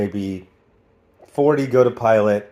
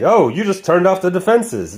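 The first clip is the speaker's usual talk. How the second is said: higher-pitched mock cartoon line; mock cartoon exposition; 7 semitones higher than usual. Character tells Kitty what she just broke, mock cartoon exposition. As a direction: announce the obvious in a raised voice. Mocks characters explaining the action.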